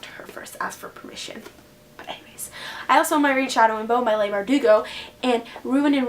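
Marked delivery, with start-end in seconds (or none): 0.0-2.5 trailing whisper; whispered aside. Real, not acted. Teen vlogger telling viewers she must ask permission, whispering. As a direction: let it slip out in a whisper.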